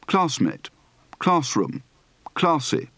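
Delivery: "class" as KLAHSS in each word; long ah in "classmate", "classroom", "classy"; open-throated A on "classy"; broad open-throated A. Shown as a demonstration. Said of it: "Practice for the broad, open-throated A".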